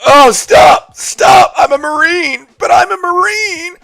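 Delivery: high mocking impression of a protesting Marine; mock panicked Eric. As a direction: squeal for mercy like a cornered victim. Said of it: "Impression of me and Chrissy J graping Eric".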